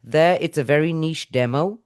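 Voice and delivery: normal talking voice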